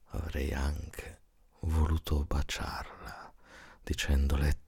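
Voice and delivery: plain reading voice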